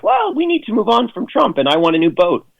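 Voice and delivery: high-pitched parody of a bought Republican; mock Republican. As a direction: mimic a turncoat partisan, voice pushed up high. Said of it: "Republicans who are gonna be like".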